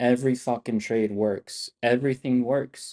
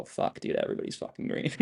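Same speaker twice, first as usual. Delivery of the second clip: a fast, breathless whisper to chat; excited whisper; 9 dB quieter than usual. Real, not acted. Streamer whispering to chat that everyone's green, excited whisper. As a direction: hush it out quickly, delighted.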